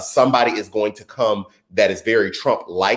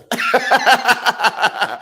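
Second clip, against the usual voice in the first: high laugh; 12 semitones higher than usual. Real, not acted. Laughs at own joke.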